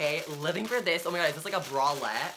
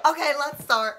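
high, loud shriek of glee; excited squeal; 11 semitones higher, 9 dB louder than usual. Real, not acted. Vlogger squealing to viewers as the unboxing begins. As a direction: squeal it, you can't wait to start. About to cut the box open.

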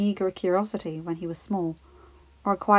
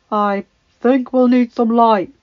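loud, slow call from below; echoing call; 3 semitones higher, 12 dB louder, slower than usual. A narrator's reading. Man calling up from the opening, echoing call. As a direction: project slowly, as if from a hole. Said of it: "his voice echoed".

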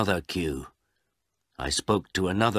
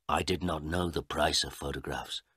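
plain reading voice

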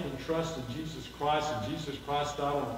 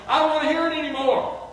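loud, much higher protest; exasperated; 12 semitones higher, 8 dB louder, faster than usual. Retold or imitated. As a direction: cry it out, fed up with hearing it. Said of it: "saying to somebody".

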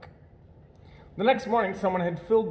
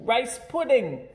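higher, slow and eager; excited puppies; 8 semitones higher, 3 dB louder, slower than usual. Acted. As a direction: savour the words like a treat. Puppies smell pudding, excited.

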